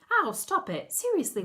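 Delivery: high-pitched, exasperated yelp; pained protest. A narrator's reading. Anna to Betty, who bit her arm, pained protest. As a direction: scold the biter, pitched right up.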